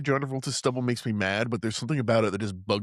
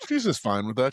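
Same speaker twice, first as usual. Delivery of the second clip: ordinary delivery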